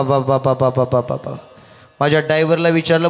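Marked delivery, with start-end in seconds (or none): 0.0-1.4 put-on mumbled old woman's ba ba; old woman babbling. Driver hears old granny mumble ba ba.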